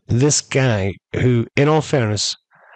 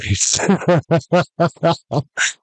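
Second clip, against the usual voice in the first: high, rapid-pulsed laugh; real laugh; 6 semitones higher than usual. Real, not acted. Storyteller amused by Kareem's comeback, real laugh. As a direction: burst out laughing at your own story.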